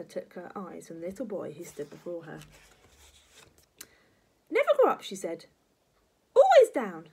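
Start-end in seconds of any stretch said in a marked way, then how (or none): 4.5-5.0 very high, loud granny cry; piercing old-woman decree; commanding grandma. Acted. 6.3-7.1 high, very loud granny decree; emphatic and imperious; commanding grandma. Acted.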